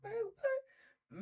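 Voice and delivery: high, soft, startled disbelief; young narrator's retold shock; disgusted surprise. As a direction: recoil in disbelief. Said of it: "I said".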